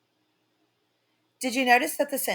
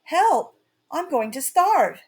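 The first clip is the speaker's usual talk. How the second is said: high, louder cry for help; shouted cry; 6 semitones higher, 4 dB louder, slower than usual. A narrator's reading. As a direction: yell it like a starving boy. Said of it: "he shouted".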